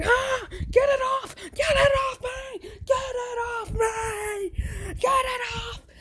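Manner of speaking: high terrified mock screams; panicked shouting. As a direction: shriek to get it off. Doctor attacked on face.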